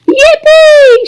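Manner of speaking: high, loud gleeful whoop; shouted yippee. A narrator's reading. Old grandfather overjoyed at going, high loud whoop. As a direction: whoop it up with glee. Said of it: "shouted Grandpa Joe again".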